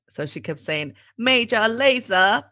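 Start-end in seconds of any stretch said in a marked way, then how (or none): 1.2-2.4 put-on slow, higher British accent. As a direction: imitate an English instructor's British accent. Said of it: "I don't really know my British accent very well".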